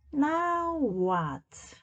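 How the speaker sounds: high, bright query; perky question. Acted. Amelia ready for the next chore, chirping high.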